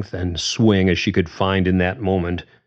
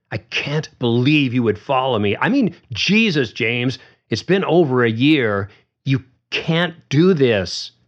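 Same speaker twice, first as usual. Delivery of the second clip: angry; 6 semitones higher than usual. A narrator's reading. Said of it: "Susan was angry now".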